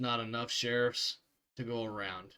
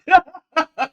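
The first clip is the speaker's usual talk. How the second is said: burst of laughter; 14 semitones higher, 15 dB louder than usual. Real, not acted.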